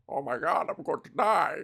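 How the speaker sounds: hammed-up dying villain, gasping about his pipe. Villain whose breathing pipe broke, hammed-up panic. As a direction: play a panicked villain sealed to his doom.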